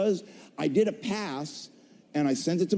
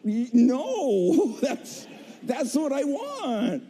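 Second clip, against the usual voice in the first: giddy; 7 semitones higher, much slower than usual. Real, not acted. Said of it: "excited correction".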